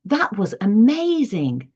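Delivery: demonstrated exclamation with a lifted, emphatic "amazing"; exclamation intonation. Shown as a demonstration. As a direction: exclaim it, pushing "amazing" up high. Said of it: "my voice goes up all the way through".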